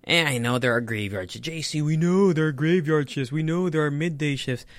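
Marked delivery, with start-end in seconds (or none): 1.5-4.7 higher, slightly put-on heckler voice; voiced objector. Viewer correcting the host, higher, slightly put-on voice. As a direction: play a know-it-all viewer correcting him.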